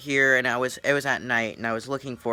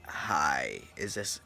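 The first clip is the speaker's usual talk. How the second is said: creepy caller; 9 semitones lower, 7 dB quieter, much slower than usual. Retold or imitated. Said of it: "he had a creepy voice".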